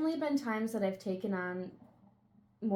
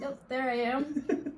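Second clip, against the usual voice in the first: slow laughing reply; 5 semitones higher, slower than usual. Real, not acted. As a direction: chuckle through it.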